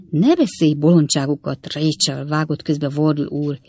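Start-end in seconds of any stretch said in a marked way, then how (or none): none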